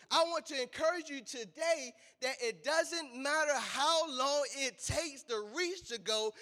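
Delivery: fired-up sermon, shouting; preaching. Acted. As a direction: preach it out with full force. Preacher builds to full force.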